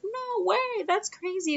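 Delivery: very high squealed imitation; imitated thrilled aunt, pitch shooting up; amazed disbelief.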